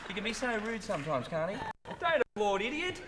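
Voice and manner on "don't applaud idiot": laughing mock scold. Real, not acted. Mock-scolding co-star amid laughter.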